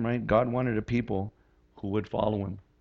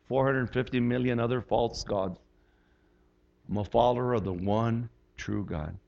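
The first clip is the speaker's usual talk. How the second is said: led prayer. Acted. Pastor leading congregation in prayer, earnest and measured. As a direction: pray it sincerely for all to follow.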